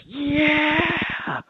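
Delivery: very high, drawn-out excited yeah; eager Antonio. Retold or imitated. Pastor imitating Antonio's overjoyed answer, very high and excited. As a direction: imitate the shaking, overjoyed young man. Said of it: "And he's like".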